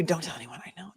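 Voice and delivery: hushed, voiceless whisper; playfully confidential whisper; mock secret whisper.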